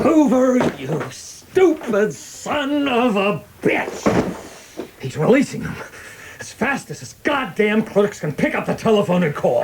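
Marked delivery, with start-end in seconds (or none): 0.0-7.8 furious outburst. Acted. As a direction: spit the insult at him. Boss furious at Hoover's failure.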